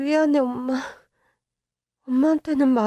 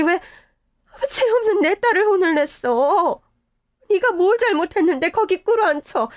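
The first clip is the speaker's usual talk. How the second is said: tearful protest; 3 semitones higher than usual. Acted. Mother outraged over daughter's treatment.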